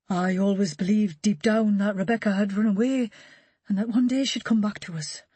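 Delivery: lightly voiced grieving father; a father rambling on from shock; Rebecca's father. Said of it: "the father made garrulous by the shock".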